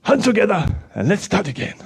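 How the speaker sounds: usual speaking voice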